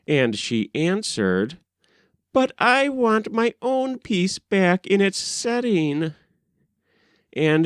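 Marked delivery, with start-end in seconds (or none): 2.3-6.1 put-on much higher girlish voice, set on her own way; the rower girl refusing the king's offer in a high girlish voice; insistent young maiden. A narrator's reading.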